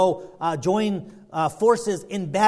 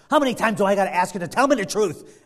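loud, quick, raised-pitch demand; king losing patience; acted exasperation; 4 semitones higher, 5 dB louder, faster than usual. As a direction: demand honesty, fed up. Acted king fed up with sarcasm.

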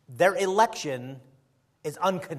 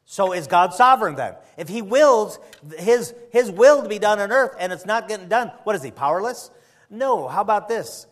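preaching; 5 semitones higher, 9 dB louder than usual. Acted. Preaching at full force.

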